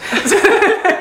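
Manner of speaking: a high burst of laughter; bright laugh. Real, not acted. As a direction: laugh at your own joke. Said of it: "my fake Irish accent".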